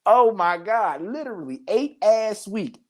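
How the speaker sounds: normal talking voice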